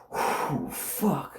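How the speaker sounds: retold hushed, airy "whew" of being floored; blown-away exhale. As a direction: breathe out hard, then a soft curse. Reenacts reaction after the line.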